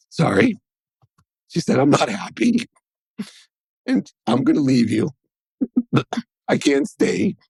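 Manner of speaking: high, breaking, tearful voice; wife saying goodbye through tears. Retold or imitated.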